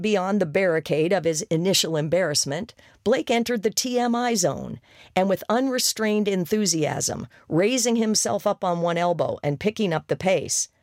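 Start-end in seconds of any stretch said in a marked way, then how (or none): none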